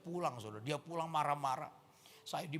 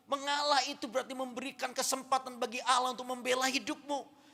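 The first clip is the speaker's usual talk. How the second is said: higher, louder preaching that drives every line to the congregation; full-force preaching; 9 semitones higher, 9 dB louder than usual. Acted. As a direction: preach it out loud and high, with rising fervour.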